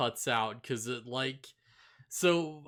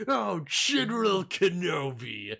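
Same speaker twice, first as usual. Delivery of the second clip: raised, loud villain greeting; higher, louder mock villain exclamation; Grievous; 5 semitones higher, 5 dB louder than usual. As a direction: call it out like a villain making his entrance. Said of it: "Grievous, like, shows up, he's like".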